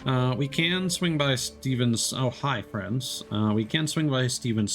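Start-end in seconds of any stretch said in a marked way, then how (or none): none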